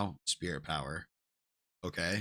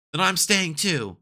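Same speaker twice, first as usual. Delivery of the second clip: put-on higher, pleading childlike voice; a child refusing to leave, lifted up; lightly voiced child; 8 semitones higher, 3 dB louder than usual. Child Char refusing to leave his mother, higher pleading voice.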